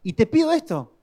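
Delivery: high, petulant plea; whiny prayer. Retold or imitated. Husband praying after a fight, in a high whiny plea. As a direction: beg God like a sulking child. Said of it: "así era mi oración".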